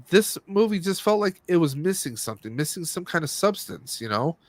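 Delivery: ordinary talk, nothing marked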